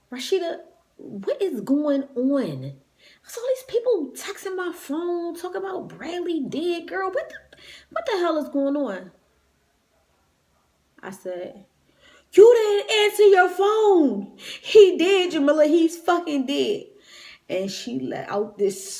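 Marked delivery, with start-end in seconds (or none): 0.1-9.1 alarmed sister. Retold or imitated. 12.3-16.8 high, loud, slow anguished yell; yelling in grief. Retold or imitated. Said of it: "I'm yelling at her".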